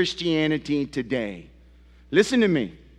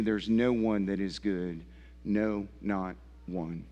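softer, lower, grave pulpit tone; quiet, weighty sermon warning; hushed solemn warning; 6 semitones lower, 5 dB quieter than usual. Acted. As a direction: drop the voice to let it sink in.

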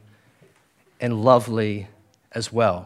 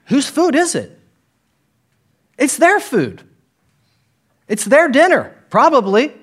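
emphatic; 11 semitones higher, 6 dB louder than usual. Real, not acted. Preacher driving home point.

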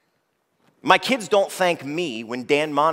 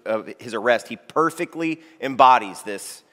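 usual speaking voice

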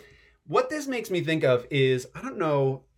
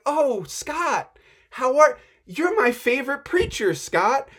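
high, bright-voiced mock pastor greeting; pretend Tim Keller; 9 semitones higher, 4 dB louder than usual. Pastor Tim Keller greeting Scott, high and warm mock voice. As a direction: greet a fan like an old friend. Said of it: "him being like".

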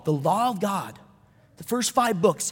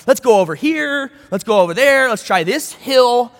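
louder, eager pushing; acted insistent urging; 3 semitones higher, 7 dB louder than usual. King of Moab pressing Balaam to curse, insistent. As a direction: urge it on, stressing each new place.

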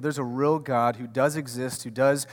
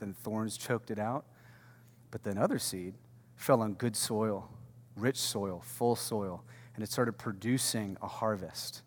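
hushed parable telling; 4 semitones lower, 8 dB quieter than usual. A narrator's reading. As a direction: draw listeners in with a hushed telling. Retelling the parable of sower.

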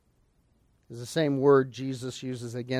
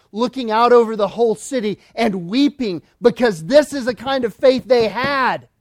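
higher, louder preacher's cry; impassioned preaching; 7 semitones higher, 7 dB louder than usual. Acted. Preacher telling of Jesus weeping over the city, impassioned preaching. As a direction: plead with the hearers as Jesus wept.

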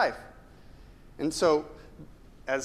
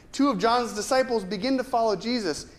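ordinary talk, nothing marked

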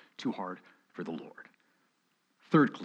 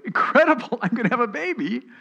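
much higher and louder, bursting with delighted laughter; joyful laughing disbelief; 12 semitones higher, 7 dB louder, faster than usual. Retold or imitated. Sarah hearing she'll have a baby, delighted laughing. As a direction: squeal it out like wonderful news. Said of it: "was she laughing the laugh of faith? Like".

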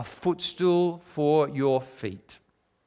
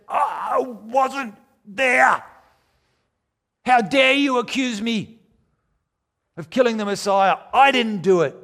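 mock outraged shout, pitched much higher and louder; indignant protest; 9 semitones higher, 5 dB louder than usual. Preacher imagining himself shouting at Peter, mock indignant. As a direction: play the wrongly accused man, hammed-up fury. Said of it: "this righteous indignation rise within me and said".

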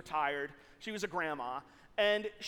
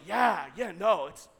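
louder, mock-earnest agreement; playing along; 3 semitones lower, 3 dB louder than usual. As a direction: pretend you know exactly what this is about. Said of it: "do I play along?".

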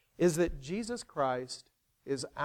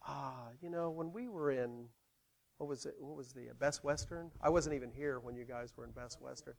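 put-on wistful old member; 10 dB quieter than usual. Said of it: "and think".

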